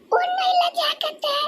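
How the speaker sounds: high, soft, slow tearful voice. Acted. Young woman brushing off elder's worry, tearful. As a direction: brush it off softly through tears. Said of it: "அழுதுட்டே இருக்க போற".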